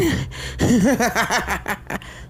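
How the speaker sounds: unhinged high chuckle; hearty laugh. Acted. Starlight laughing over the wrecked animatronic, gleefully. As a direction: laugh like you finally won. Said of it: "She chuckled heartily".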